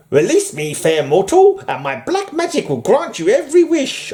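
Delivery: high, lilting mock-Irish genie; Irish leprechaun accent. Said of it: "made him sound more Irish".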